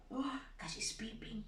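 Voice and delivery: hushed unvoiced aside; whisper. Shown as a demonstration. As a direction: whisper it to the children.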